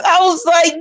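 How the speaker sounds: ordinary talk, nothing marked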